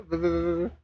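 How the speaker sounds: ordinary delivery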